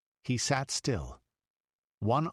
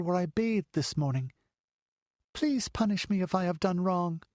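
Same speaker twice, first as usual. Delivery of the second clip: put-on much higher child's voice, begging; a small girl asking forgiveness; pleading little girl; 10 semitones higher than usual. A narrator's reading. The child confessing to her lady, higher pleading girl's voice.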